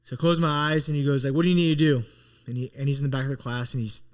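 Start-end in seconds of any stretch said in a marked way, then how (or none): 1.3-2.0 loud, higher demanding question; retold coach getting louder. Coach challenges student from back of the class, getting louder. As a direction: fire the question out like an insistent coach. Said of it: "he keeps getting louder".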